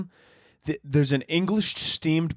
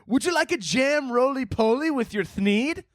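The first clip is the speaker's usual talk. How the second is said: high, put-on storybook voice; mock a silly dessert offer up high; high-pitched whimsical question, rising at the end; whimsical Seussian server; 9 semitones higher than usual. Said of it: "That's what it sounds like".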